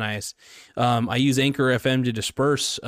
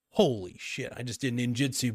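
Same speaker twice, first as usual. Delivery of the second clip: ordinary talk, nothing marked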